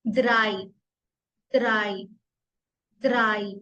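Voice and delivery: द्राई said "drai" with a d, not th; careful repetition of द्राई; German drei. Shown as a demonstration. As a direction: drill द्राई three times, falling. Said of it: "थ्री".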